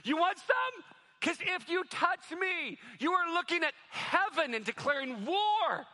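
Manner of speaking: high, loud, cocky dare; taunting challenge. Retold or imitated. Brahim daring the threatening men, high and taunting. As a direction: taunt them, daring them to try. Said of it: "Brahim is like".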